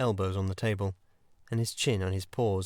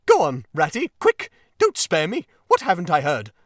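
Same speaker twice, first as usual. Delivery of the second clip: high, loud, urgent pleading; frantic Toad; 9 semitones higher, 9 dB louder than usual. Acted. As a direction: beg for the news in a panic. Toad frantic for bad news.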